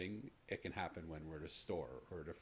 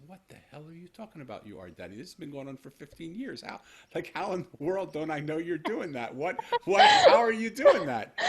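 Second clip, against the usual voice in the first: overwhelmed disbelief; 11 semitones higher, 22 dB louder than usual. Retold or imitated. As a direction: voice climbing higher and louder, breaking into laughing sobs. Father's overwhelmed retold outburst.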